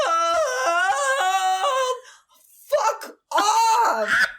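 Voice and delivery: high pained wail; anguished groan. Real, not acted. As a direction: wail it out in comic disgust. Reacting to hated song.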